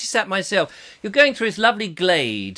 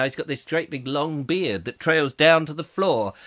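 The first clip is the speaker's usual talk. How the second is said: normal talking voice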